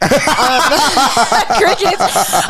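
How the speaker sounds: crickets said through real laughter; laughing. Real, not acted. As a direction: laugh it off slowly. Joke falls flat, laughing.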